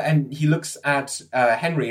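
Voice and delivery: ordinary talk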